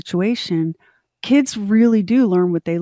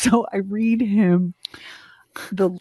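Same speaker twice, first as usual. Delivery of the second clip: whispered laugh. Real, not acted. Laughing at the bad letter.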